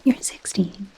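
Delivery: You're sixteen spoken out loud, firm fall; voiced aloud. Shown as a demonstration. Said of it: "repeated aloud after whisper".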